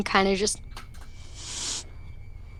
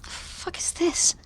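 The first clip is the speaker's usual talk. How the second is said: higher, breathless whispered disbelief; stunned whisper; 8 semitones higher, 8 dB quieter than usual. Retold or imitated. Aspen reacting to the strange book, in a stunned whisper. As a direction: hiss it under your breath. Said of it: "Aspen just immediately is like".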